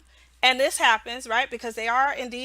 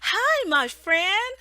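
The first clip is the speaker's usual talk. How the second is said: higher, slow "Hi, my friend"; slow friendly teacher greeting; 8 semitones higher, much slower than usual. Retold or imitated. Teacher greeting the mock student, slow and higher. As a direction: greet the child slowly and kindly. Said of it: "and I was like".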